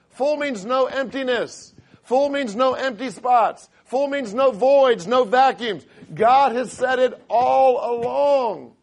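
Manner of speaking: loud, slow, high-pitched preaching, hammering the word Full; preaching at full force. Acted. Preacher urging his church that full means full. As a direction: build from reading into a shouted climax.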